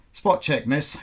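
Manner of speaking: brisk official stopping a traveller; customs officer. A narrator's reading. Customs officer stopping Elena at the airport, brisk and official.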